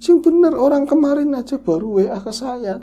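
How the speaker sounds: high, loud protesting scold; angry disbelief. Retold or imitated. Mother insisting the cousin just messaged her, loud high protest. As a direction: refuse to believe it, loudly. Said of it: "marah-marah kan".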